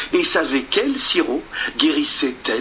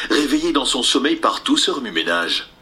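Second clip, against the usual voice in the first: plain reading voice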